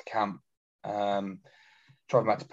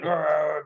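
higher mock Father Jack yell, louder; 7 semitones higher, 5 dB louder than usual. Wheeled down the corridor, a hammed-up Father Jack yell. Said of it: "Father Jack going".

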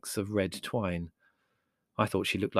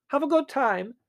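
very high, piping farewell; cheery eccentric aunt; dotty aunt, cheery; 5 dB louder than usual. Acted. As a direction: call it out obliviously.

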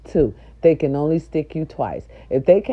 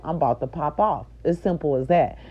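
ordinary talk, nothing marked

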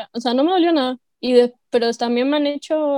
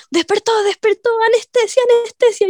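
mock urgent staff; 9 semitones higher than usual. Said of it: "Y todos como".

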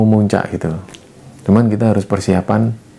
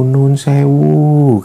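put-on drawn-out, slow, higher old woman's call; eerie old lady, long-held syllables; 4 semitones higher, much slower than usual. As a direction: imitate an old woman calling, stretching each word. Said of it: "suara nenek-nenek tadi yang bilang ke aku".

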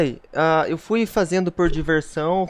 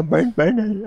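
a lighter put-on voice for banana; a quick, half-hearted retry of her banana; imitating the mute girl; 4 semitones higher, slower than usual. Prodded by a friend to imitate her again, light imitation. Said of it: "ela fala assim, ó".

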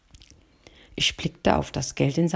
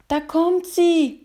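put-on high, slow boyish call; boy shouting; 11 semitones higher, slower than usual. Older boy spots the shop owner coming, high boyish call. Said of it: "schrie der Ältere".

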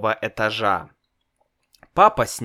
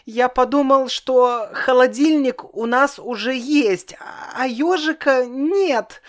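put-on high little boy's voice, much higher; child voice; 13 semitones higher, 3 dB louder than usual. A narrator's reading. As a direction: play a small boy, innocent. Child explains timidly.

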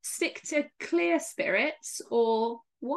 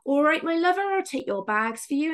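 imitated Somerset concierge; 6 semitones higher, 5 dB louder than usual. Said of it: "the Somerset accent".

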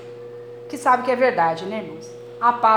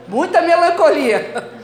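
louder, higher, laughing complaint; laughing protest; 6 semitones higher, 6 dB louder, slower than usual. Retold or imitated. Mother jokingly telling God it is too much, laughing protest.

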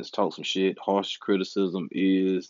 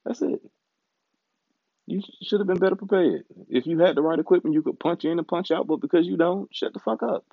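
higher, berating himself by name; scolding inner voice; 8 semitones higher than usual. Retold or imitated. Scolding himself for not having the right equipment, higher mock lecture. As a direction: lecture yourself like a fed-up critic. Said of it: "it's like, [name]".

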